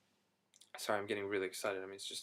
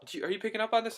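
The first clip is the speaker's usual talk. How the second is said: high, louder incredulous ask to his cousin; amazed question; 11 semitones higher, 4 dB louder, much faster than usual. Retold or imitated. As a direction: pitch shoots up in wide-eyed disbelief. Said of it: "And I'm just like".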